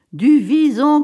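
loud, high-pitched exclamation; triumphant cry. A narrator's reading. Husband unveiling mink to his wife, exultant cry. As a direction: cry it out as a grand reveal. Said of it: "cria-t-il".

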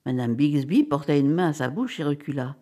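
plain reading voice

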